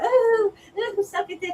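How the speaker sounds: high-pitched prissy prude voice; mock prude. Mocking prudes who gasp at a crude word, in a high prissy voice. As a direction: put on a shocked, prudish gasp. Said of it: "one of these people that".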